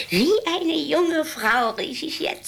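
imperious grande dame. Acted. Old woman boasting of her smell.

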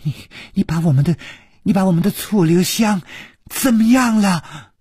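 faltering, restarting, rising in pitch; trembling voice. A narrator's reading. Song Tian'er demands news of their friend, voice trembling. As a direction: stammer towards an anguished high question. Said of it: "颤声说".

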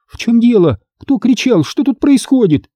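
high, anxious rush of questions; worried mother, high-pitched; alarmed blurting. A narrator's reading. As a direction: demand to know what happened, alarmed. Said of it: "встревоженно выпалила она".

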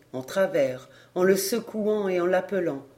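usual speaking voice

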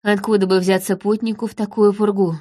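usual speaking voice

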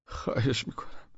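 whispered pleading breath; whispered plea. Acted. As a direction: beg under your breath. Begging in pain. (التماس نجواگونه)

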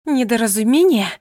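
громко, с негодованием; переспроси в возмущении; возмущённое удивление. A narrator's reading. (возмущённо переспрашивает Ромаля)

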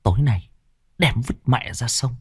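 soft conspiratorial murmur; wife plots in her husband's ear. A narrator's reading. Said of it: "rì rầm vào tai chồng". (rì rầm thì thầm)

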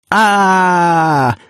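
long, drawn-out "aaah" scream; high sustained whoop; full-throated yell. A narrator's reading. Ignat watching the plane finally climb, yelling at the top of his lungs. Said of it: "заорал Игнат во всю глотку".

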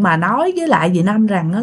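usual speaking voice

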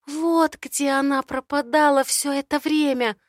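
Mel's voice. Acted. Mel voiced, indignant.

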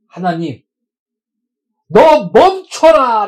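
high, loud, slow cry of defiance; loud, slow, pleading shout at God; bold prayer shout. Acted. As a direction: hurl it up to heaven, drawn out.

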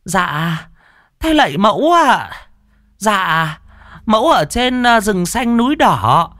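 high, drawn-out ritual wail; wailing devotee. Retold or imitated. Devotees wailing prayers to Mẫu, high slow keening. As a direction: beg the goddess in a keening plea. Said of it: "tiếng gào thét".

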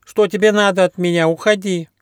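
high-pitched, scared platypus; frightened cry. A narrator's reading. Platypus to a stray dog, frightened cry. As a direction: cry out in fright to drive someone off. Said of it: "испуганно крикнул".

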